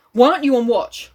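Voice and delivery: angry. A narrator's reading. Chief confronting Roger off watch, mildly angry. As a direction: demand it with a touch of anger. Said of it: "the chief spoke to him angrily".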